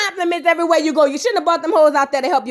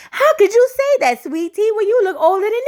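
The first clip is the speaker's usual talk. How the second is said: sassy falsetto scolding; incredulous mock; 7 semitones higher than usual. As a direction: throw it up high in disbelief. Mocking Sweet Tea.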